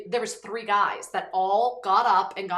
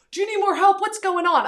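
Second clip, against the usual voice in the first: higher and louder, keen bystander voice; eager helpers; 11 semitones higher, 9 dB louder than usual. Retold or imitated. Texan guys in the club offering help, loud and eager. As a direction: jump in like a rescuer calling over music. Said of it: "they're like".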